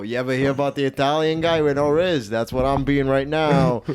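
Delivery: Italian wise guy pitching his bit; in character, Italian guy. Shown as a demonstration. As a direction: stay in the Italian-American character. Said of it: "I say it within the character".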